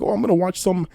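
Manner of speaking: mild mock of a casual creep. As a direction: play the creep as if it's nothing. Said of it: "you're just drinking some tea and like".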